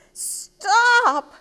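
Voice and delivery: high, loud, drawn-out yell; exasperated storybook cry. Acted. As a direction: yell to halt the chaos. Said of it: "cried Ava".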